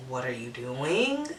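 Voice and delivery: low, soft, drawn-out question rising at the end; hushed, slow mock bewilderment; weirded-out disbelief. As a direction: stretch it out slowly, quietly baffled.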